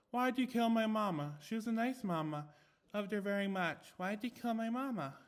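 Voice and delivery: high, eerie pull-string doll voice; a broken toy repeating its accusation; creepy doll. A narrator's reading. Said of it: "She kept pulling the cord".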